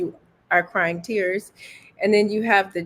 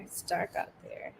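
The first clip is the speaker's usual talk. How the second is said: whispered spooky line; 13 dB quieter than usual. Real, not acted. Spooky dark joke.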